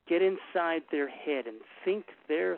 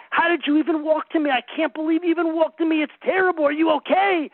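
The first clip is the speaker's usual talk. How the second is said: much higher and louder, hammed-up alarm; mock panic; 11 semitones higher, 10 dB louder than usual. Father mirroring his child's pain back, in loud mock panic. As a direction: overreact in mock horror at the blood. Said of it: "my response became".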